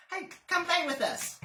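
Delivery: high, loud teasing bunny voice; playful wild rabbit. Acted. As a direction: call out like a cheeky rabbit.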